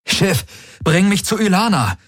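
very high, desperate calling; urgent command. A narrator's reading. Galen ordering the unresponsive ship, urgent call.

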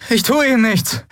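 put-on pleading young man. Kai reassures stranger.

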